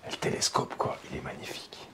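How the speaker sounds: breathy hushed whisper. Real, not acted. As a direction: whisper it in awe. Hushed look round abandoned house.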